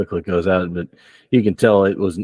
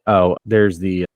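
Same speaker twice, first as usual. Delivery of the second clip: normal talking voice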